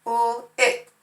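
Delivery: Ul-ick split into two parts; segmented nonsense syllable. Shown as a demonstration. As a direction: sound out Ul-ick slowly. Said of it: "this here is a nonsense word".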